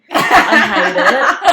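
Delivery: loud, drawn out through laughter; slow, loud, giggly revulsion; laughing disgust. Real, not acted. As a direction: laugh helplessly while owning up to loathing. Laughing disgust about spiders.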